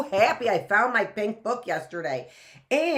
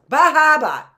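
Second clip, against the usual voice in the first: punchy loud Boston accent, higher pitch; 10 semitones higher, 13 dB louder than usual. Shown as a demonstration. Repeating her Boston accent demo for viewers, loud. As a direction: hit the Boston accent hard. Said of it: "That's my Boston accent".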